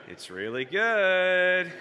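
slow, stretched-out imitated sales pitch; coaxing. Friend persuading the manager to make a float, drawn-out coaxing.

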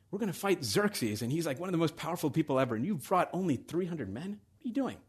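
acting out a leader who feels tricked; duped, incredulous. Daxos questioning Leonidas's three hundred men, incredulous. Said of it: "He feels like he's been duped".

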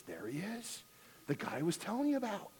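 husband whispering to wife about the TV guy; man's pleased remark. Retold or imitated. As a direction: point him out quietly to his wife. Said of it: "He says".

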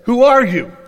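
loud startled question; jolted awake, demand it. Retold or imitated. Boaz waking to a woman at his feet, loud startled question. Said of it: "the man was startled".

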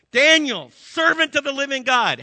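loud, high, anguished cry of the king; anguished call. Acted. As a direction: call out desperately into the den. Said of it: "he called out to Daniel in an anguished voice".

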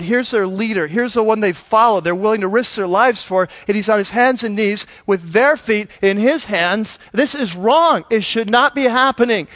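ordinary delivery

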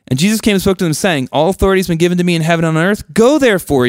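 lifted, earnest Bible reading, a bit fast; scripture reading. Acted. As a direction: read the verse with weight and lift. Reads scripture aloud.

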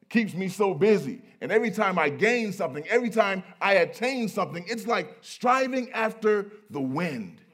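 loud, high preacher's lament; big oratorical wail of complaint; full-force preaching. Acted. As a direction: cry it out from the pulpit. Preaching in Solomon's person.

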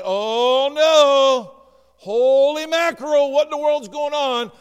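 loud alarmed cry; hammed-up panicked enemy; mock panic. Quaking enemy sees the dark clouds, in mock panic.

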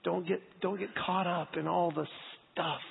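softly voiced, as God speaking to the heart; gentle inner whisper. God speaking softly to the pastor's heart, gentle. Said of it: "God just whispers in my heart".

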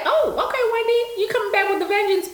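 high, delighted gasp of approval; impressed. Retold or imitated. Viewer cheering Wendy's new bob, high and delighted. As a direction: cheer Wendy's comeback look. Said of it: "I was like".

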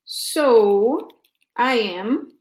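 ordinary talk

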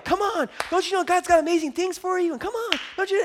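high, pushy mock hype voice; pushy hype preacher. Mocking pushy preachers who pummel the church with hype. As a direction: parody the over-eager preacher. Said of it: "you hear people go like".

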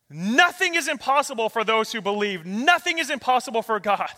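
loud, high declaration of faith; ringing preacher's rebuttal; emphatic proclamation. Acted. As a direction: proclaim it with full conviction. Jesus's emphatic answer, preached.

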